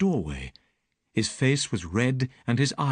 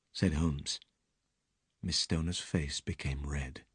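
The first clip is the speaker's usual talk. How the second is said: usual speaking voice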